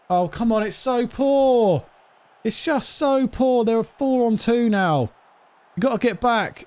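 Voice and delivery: high, drawn-out wail of frustration; exasperated protest. Real, not acted. Gamer to his players as they are caught out, exasperated. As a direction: plead in despair at the team, pitch way up. Exasperated at team's poor play.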